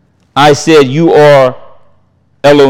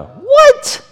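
what, squeaked far higher in disbelief; shocked what. Acted. Preacher mocking his own shock at being called possessed, squeaking. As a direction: act floored by the accusation.

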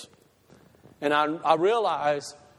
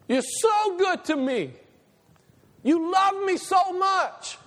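high, slow, rising to a shout of praise; praising God; 12 semitones higher, slower than usual. Acted. Believers telling God he is the best, high and swelling. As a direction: pour it out to God, building. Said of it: "we just wanna tell him".

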